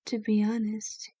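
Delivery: ordinary talk, nothing marked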